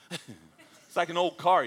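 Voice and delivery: slow, laughing through the words. Real, not acted. Preacher laughing at the broken exciter button, slow. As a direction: chuckle it out slowly.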